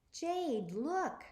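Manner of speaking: high, shaky and startled; frightened sister, voice trembling. A narrator's reading. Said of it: "in a shaky voice".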